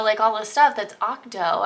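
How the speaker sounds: ordinary delivery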